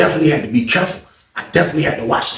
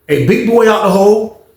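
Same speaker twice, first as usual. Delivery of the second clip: taunting call; 7 semitones higher, 3 dB louder than usual. Retold or imitated. Teasing the suspected snitch.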